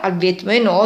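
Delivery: ordinary delivery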